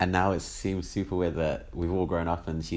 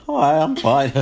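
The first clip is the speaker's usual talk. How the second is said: put-on squeaky, louder teenage voice crack; cracked high voice; 8 semitones higher, 11 dB louder, slower than usual. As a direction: voice jumps up high as it breaks. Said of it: "who are you?".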